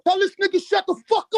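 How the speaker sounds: yelling in frustration; angry shout. Real, not acted. Furious at interrupting callers.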